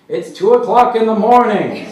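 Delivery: full-force high yell; loud, outraged holler at the noise; angry neighbour's shout. A narrator's reading. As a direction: bellow it from a window at night. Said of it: "someone finally shouts from a window".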